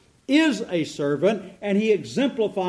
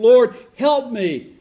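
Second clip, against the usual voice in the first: louder, slow, begging plea; pleading; 4 semitones higher, 8 dB louder, slower than usual. Acted. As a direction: beg him slowly for help. Kneeling woman begs Jesus.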